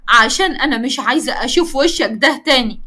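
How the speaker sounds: plain reading voice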